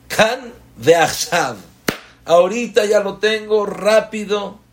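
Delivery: mock entitled voice demanding it right away; mock impatient demand. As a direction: act out a spoiled person who wants it now. Mocking an impatient, spoiled demand.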